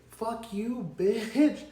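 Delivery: a half-joking cuss with a laugh; laughing mock insult. Laughing retort at coworker.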